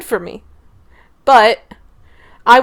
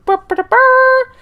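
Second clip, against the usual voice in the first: silly burr noise; 4 dB louder, slower than usual. Pretend. Playful noise announcing ram.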